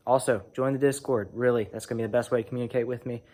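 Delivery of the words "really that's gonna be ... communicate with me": ordinary talk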